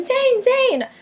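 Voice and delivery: high-pitched calling of a name. Retold or imitated. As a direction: call his name out across the arena. Friend calling out to Zayn.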